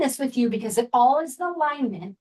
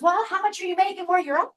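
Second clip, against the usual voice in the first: quick, higher voiced dad question; 8 semitones higher, faster than usual. Dad asks his daughter about pay, higher and quick. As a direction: quiz her like a practical father, up and fast. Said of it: "And he goes".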